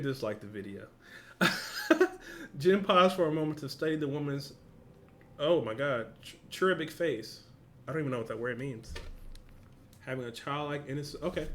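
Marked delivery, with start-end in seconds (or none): none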